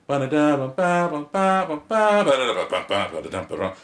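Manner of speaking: high scatted horn line; imitated horn. Showing how the poem sounded on horn, in scatted horn notes. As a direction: mimic a horn, starting high and tumbling down. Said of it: "hear a horn and go".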